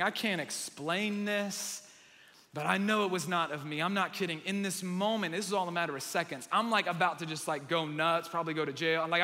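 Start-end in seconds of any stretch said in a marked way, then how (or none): none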